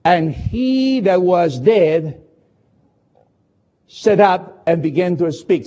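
slow, deliberate reading, pause after dead; don't rush a word of it; slowly. Shown as a demonstration. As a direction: read "And he that was dead" slowly and weighed. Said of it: "Don't read the last sentence of verse fifteen too quickly".

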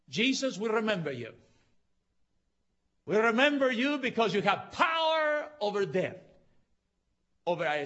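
loud, high, impassioned sermon delivery; full-force preaching. Real, not acted. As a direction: preach it hard, driving to a climax.

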